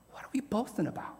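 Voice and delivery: "what are we boasting about?" in a breathy whisper. Shown as a demonstration. Preacher whispering the question back to the church. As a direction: drop to a whisper on "we".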